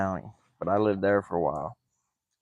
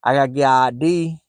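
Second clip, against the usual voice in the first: higher and louder, "I D" run together toward "idea"; mock local "I D"; 4 semitones higher, 6 dB louder than usual. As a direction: imitate the locals saying ID. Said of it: "they say idea".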